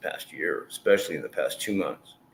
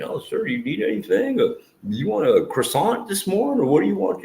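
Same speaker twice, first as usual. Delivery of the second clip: much higher, sugary guard voice; mock polite jail guard; 12 semitones higher than usual. Retelling how kind the jail guards were, high mock-polite voice. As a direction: lift way up into an overly courteous guard.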